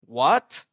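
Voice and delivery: "What?" jumping high and loud; a startled rising "What?"; "What?" as a model for the second tone. Shown as a demonstration. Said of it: "feeling of how you should apply".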